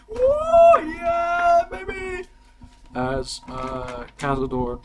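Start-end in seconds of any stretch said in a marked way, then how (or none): 0.1-2.3 high-pitched cheer; cheering crowd. Acted. Game master acting the crowd's welcome, high cheering. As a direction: whoop like an arena fan.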